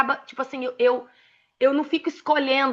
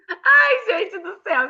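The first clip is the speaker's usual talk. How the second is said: laughing; 8 semitones higher, 4 dB louder, slower than usual. Real, not acted. Laughing at her own blunder.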